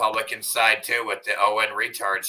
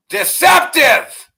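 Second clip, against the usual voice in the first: slow, drawn-out shout; sudden shout; 12 dB louder, much slower than usual. Real, not acted. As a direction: bellow the word out slowly. Ranting about deceptive psychopaths.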